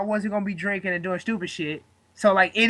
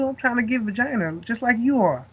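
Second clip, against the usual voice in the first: ordinary delivery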